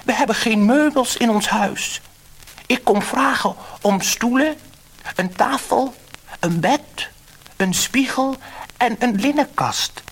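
high, loud hopeful asking; a little man begging the fish for a house; high and loud, eager request; pleading fisherman. A narrator's reading.

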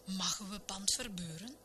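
usual speaking voice

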